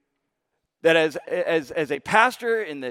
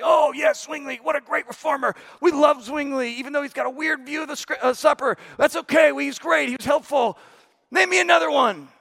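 professor; 9 semitones higher than usual. Retold or imitated. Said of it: "And he says".

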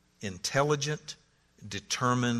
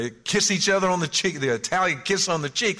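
loud, high hammed-up Italian affection; mock Italian gusher; 9 semitones higher, 7 dB louder than usual. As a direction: gush it like a caricatured Italian family. Mock Italian family warmth.

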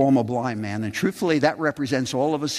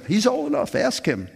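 light mock shrug, first words pitched up; parents deflecting; 11 semitones higher than usual. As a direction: toss the question back, half amused.

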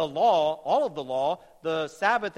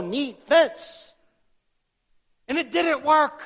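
loud, high, impassioned preaching; full-force preaching; 5 semitones higher, 7 dB louder than usual. Real, not acted. Preacher rebukes oversimplified theology to the congregation, at full force. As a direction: thunder it out to the congregation.

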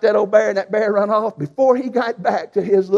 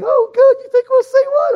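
much higher, louder wife's voice; eager wife; 7 dB louder than usual. Retold or imitated. Wife eagerly asking husband about the bear, high and loud. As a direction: play the thrilled young wife.

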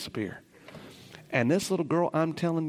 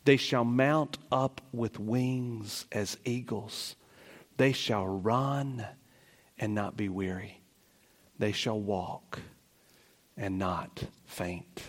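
slow solemn reading; 2 semitones lower, slower than usual. Acted. Slow climactic scripture reading.

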